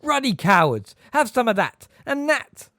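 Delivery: loud, high roar with each blow; furious roar. A narrator's reading. Hagrid punching his attackers, furious roaring. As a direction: roar as you swing your fists.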